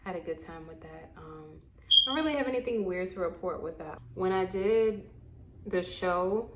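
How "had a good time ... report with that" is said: ordinary talk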